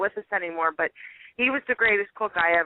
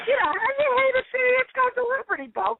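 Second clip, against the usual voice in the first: incredulous; 14 semitones higher, faster than usual. Real, not acted. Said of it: "incredulous about Philly hate".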